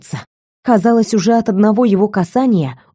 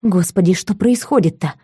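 ordinary delivery

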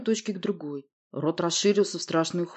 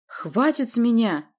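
slow, raised angry bark; barked outburst; 6 semitones higher, 4 dB louder, slower than usual. A narrator's reading. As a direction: bark it, fed up. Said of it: "рявкнул Урий".

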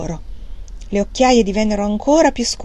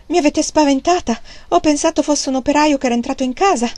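high, quick frightened complaint; high-pitched fretful alarm; startled; 7 semitones higher than usual. Acted. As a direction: blurt it out like a scare just had. Startled Fanny complains to her mother.

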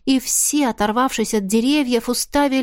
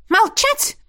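much higher shrill yell; shrieking order; 13 semitones higher than usual. A narrator's reading. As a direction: shriek for silence. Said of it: "взвизгнула Марлиза".

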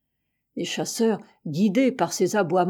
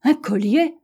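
higher-pitched questioning echo; surprised echo; 5 semitones higher, 4 dB louder than usual. A narrator's reading. Doctor echoes startling discovery. (question étonnée, voix plus haute)

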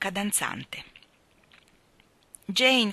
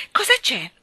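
loud, high, eager question; exclaiming; 6 semitones higher, 9 dB louder than usual. A narrator's reading. Jane sitting up to ask Michael, eager exclamation. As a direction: burst out with curiosity. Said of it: "esclamò Jane tirandosi a sedere".

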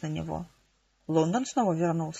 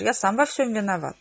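usual speaking voice

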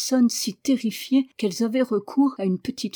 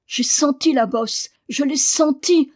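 high, lightly acted wail; moaning; 5 semitones higher, 5 dB louder than usual. A narrator's reading. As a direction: complain in rising distress. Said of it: "gémit Daniel".

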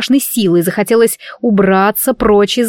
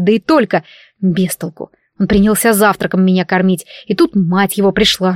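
usual speaking voice

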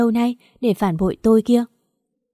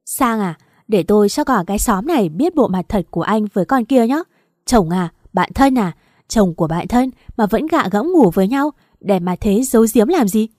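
louder defiant yell; louder angry taunt; furious shout; 4 dB louder than usual. Acted. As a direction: dare the whole village to hear. Wife defying husband's plea.

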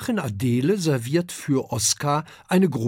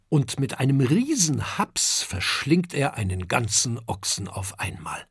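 ordinary delivery, nothing marked

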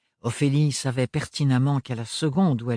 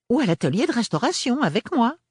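much higher, louder, eager offer; encouraging; 11 semitones higher, 4 dB louder than usual. A narrator's reading. Aunt Roseline offering Ophélie work, encouraging. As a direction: coax her along cheerfully. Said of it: "d'une voix encourageante".

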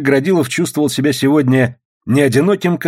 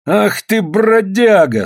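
high, drawn-out indignant cry; angry exclamation; 9 semitones higher, 4 dB louder, much slower than usual. A narrator's reading. Сукнов hitting back at the man, high indignant cry. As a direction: stretch the insult out high. Сукнов strikes his attacker.

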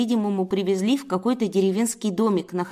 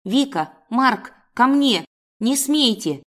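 raised, urgent calling out; commanding cry; 4 semitones higher, 4 dB louder, slower than usual. Acted. Woman calling cubs off the man, commanding cry. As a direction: call them back sharply. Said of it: "я воскликнула".

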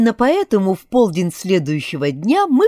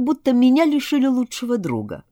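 ordinary delivery

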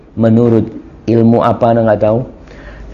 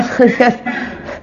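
breathy laugh; 9 semitones higher, 4 dB quieter than usual. Real, not acted. Preacher laughs at the audience.

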